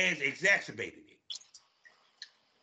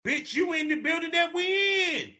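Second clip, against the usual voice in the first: high, loud hammed-up yell; mock shout; 13 semitones higher, 5 dB louder than usual. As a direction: yell it like a heated club argument. Mock yelling at IG girls.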